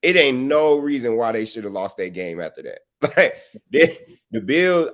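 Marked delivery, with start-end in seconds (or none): 3.0-4.9 laughing. Real, not acted. Laughing at Allen's bad game.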